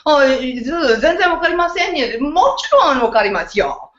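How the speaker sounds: hammed-up Japanese outburst, pitched high; over the top. Host acting her excited reply to a new friend, over the top. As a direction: exaggerate the Japanese reply with big swooping tune. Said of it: "a little bit over the top".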